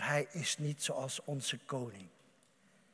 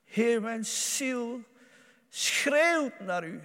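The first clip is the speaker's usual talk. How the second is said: slow, higher, stretched out plea; slow fervent cry; 7 semitones higher, 4 dB louder, much slower than usual. Acted. Preacher cries out to the Lord, slow and pleading. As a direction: draw out each word as a pleading cry.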